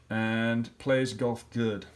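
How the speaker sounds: plays said slowly, stressed and falling; stressing the error. Shown as a demonstration. Teacher to class, slowly stressing the wrong word plays. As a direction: lean hard on plays, slowly. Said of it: "something wrong with my predicate".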